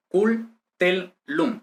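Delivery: "cul, tel, lum" in three separated syllables. Shown as a demonstration. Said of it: "consonante geminada se transcribe".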